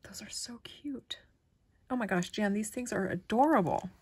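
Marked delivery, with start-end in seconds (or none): 0.0-1.2 soft whispered delight. Real, not acted. Unwrapping a friend's gift, whispering cute. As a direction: breathe it out, charmed.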